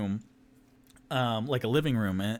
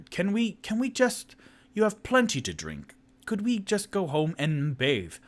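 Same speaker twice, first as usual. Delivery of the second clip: lightly voiced character; 8 semitones higher than usual. Toot Toot replies.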